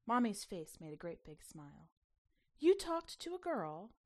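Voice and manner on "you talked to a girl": higher, louder, thrilled gasp of a question; mother overjoyed her shy daughter noticed a girl, delighted surprise. Acted. Mommy thrilled at news.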